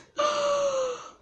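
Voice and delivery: high-pitched mock gasp; dramatic gasp. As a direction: gasp theatrically after the reveal. Mock shock at tough part.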